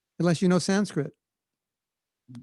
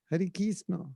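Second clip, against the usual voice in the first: soft Bengali-accented "Kisno"; 2 semitones higher, 7 dB quieter than usual. Shown as a demonstration. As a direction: say it as heard in Bengal. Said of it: "That's Bengal".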